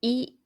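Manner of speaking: い said short with the lips spread wide; a clear, crisp い; spread-lip i demo. Shown as a demonstration. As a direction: model vowel い. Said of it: "口を横に広げて発音します".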